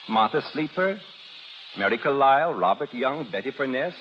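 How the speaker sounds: ordinary talk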